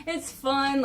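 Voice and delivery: usual speaking voice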